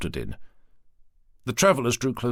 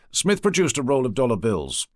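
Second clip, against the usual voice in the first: usual speaking voice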